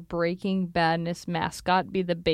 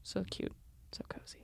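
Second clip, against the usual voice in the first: whispering; 18 dB quieter than usual. Pretend. Whispered cooing over bears.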